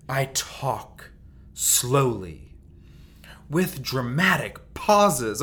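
slow pulpit delivery, each phrase set apart by dramatic pauses; slow it right down and let the pauses land like a sermon; preacher's voice. Shown as a demonstration. Podcaster showing listeners his preaching voice, slow and dramatic. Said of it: "I get preaching voice".

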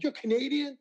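imitating a neighbor's high, surprised question; surprised disbelief. Old neighbor, hearing he's Canadian, asks in mock surprise.

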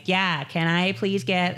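put-on high, slow wasted-girl voice; drunk Valley girl. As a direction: order like a tipsy Valley girl.

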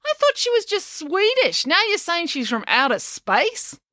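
very high, slow astonishment; incredulous. A narrator's reading. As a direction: question it in high-pitched bewilderment. April's shocked misunderstanding.

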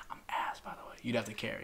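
unvoiced confiding whisper. Real, not acted. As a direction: whisper it like a secret. Confessing he is bad at Warzone.

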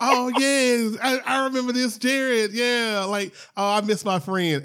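high-pitched imitation of a nostalgic friend; mock fond remembrance. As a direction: play suddenly remembering a lost friend. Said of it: "you're gonna be like".

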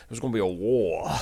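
imitated Southern accent, laid on thick. Podcaster recalls the actor laying it on thick, hammed-up Southern accent. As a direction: mimic an overdone Southern accent. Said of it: "he was laying it on thick there".

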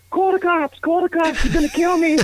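hammed-up panic, pitch shooting up; panicked Henry. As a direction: squeal like a terrified man begging for help. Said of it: "He says".

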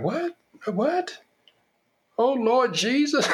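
very high, startled squeals then a slow plea to the Lord; hammed-up pious shock; shocked grandmother. Grandmother hearing Junior is Republican, squealing in hammed-up shock. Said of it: "she's like".

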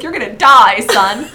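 a laughing, hammed-up fatherly warning; laughing mock warning. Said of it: "He was like".

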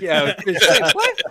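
laughing disbelief. Real, not acted. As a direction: laugh through it, then squeak "what?". Players laughing at price.

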